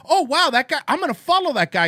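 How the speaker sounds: loud, high mock enthusiasm; loud sarcastic gushing; sarcastic excitement. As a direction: fake delight at a tweet that annoys you. Sarcastic reaction to recommended tweet.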